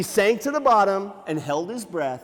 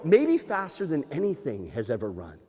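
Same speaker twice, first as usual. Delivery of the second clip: usual speaking voice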